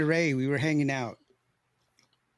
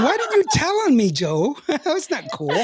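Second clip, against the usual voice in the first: mock indignant; 8 semitones higher than usual. Mock complaining to friend Joe.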